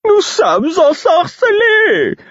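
very high, slow singsong jester; bouffon aimable. A narrator's reading. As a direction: sing it out sweetly, loud and drawn out. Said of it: "fit le fou d'un ton aimable". (ton aimable, aigu et chantant)

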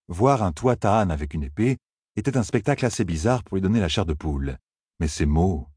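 plain reading voice

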